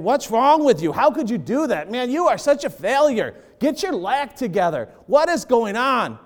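put-on a frustrated parent yelling at their child, scolding. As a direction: berate a child who failed you. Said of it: "instead of saying".

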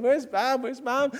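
retold tearful calling. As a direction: call out for mom like the crying kid. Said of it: "and I'm crying".

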